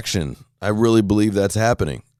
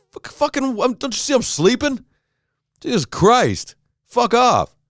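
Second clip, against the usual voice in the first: much higher, exasperated mock yell; mock outburst; 13 semitones higher than usual. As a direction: squeal it out like a rudely woken sleeper. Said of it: "And I'll be like".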